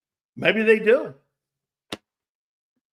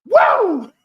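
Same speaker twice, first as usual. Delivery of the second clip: loud, high-pitched whoop; 11 semitones higher, 11 dB louder than usual. Real, not acted. Host reacting to his own joke, loud whoop. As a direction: let out a big high woo.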